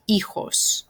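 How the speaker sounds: "hijos" as EE-hos, silent first H; "hijos" carefully modelled in Spanish; model pronunciation. Shown as a demonstration. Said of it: "J is more like an H sound".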